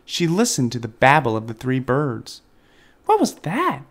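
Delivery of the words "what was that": put-on high baby-bird cry; startled little bird, pitched way up. A narrator's reading. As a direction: squeal the question like a chick. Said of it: "cried Flap".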